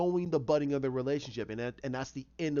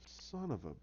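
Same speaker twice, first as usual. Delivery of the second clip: much softer, trailing off; muttered exasperation; 3 semitones lower, 12 dB quieter than usual. Retold or imitated. Jim left alone as he turns around, muttered exasperation. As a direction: cut the curse short, under your breath.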